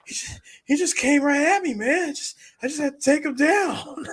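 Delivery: high, put-on voice; exaggerated high-pitched self-justifying; mock excuse-making. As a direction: plead it up high in imitation. Acting out McLovin's boastful excuse.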